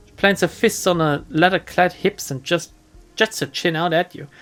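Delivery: normal talking voice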